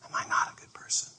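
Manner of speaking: breathy whispered self-doubt. Retold or imitated. A man at forty whispering to himself, doubting. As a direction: ask it under your breath, lost.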